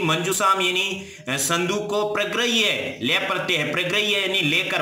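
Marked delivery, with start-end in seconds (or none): none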